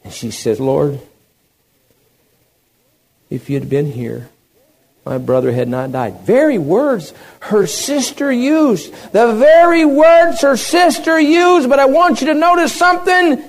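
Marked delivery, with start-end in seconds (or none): 0.6-6.2 slight, understated sorrow; subdued grief. Acted. Weeping Mary at Jesus' feet, subdued grief. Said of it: "she begins to weep". 6.2-13.4 high, loud sermon cry; full-force preaching. Real, not acted. Preacher warning the church to sit and listen, full-force preaching.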